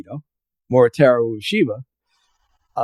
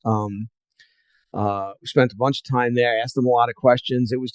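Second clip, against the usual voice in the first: usual speaking voice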